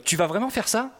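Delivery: high-pitched sceptical query, exaggerated; imitated doubting question. Colleague imitates host second-guessing him, doubtful questioning. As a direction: mimic a colleague questioning the choice. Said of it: "tu fais des trucs du genre".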